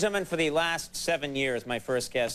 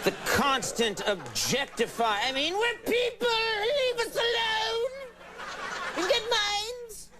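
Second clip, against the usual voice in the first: mock outrage; slower than usual. Mock outrage about being objectified.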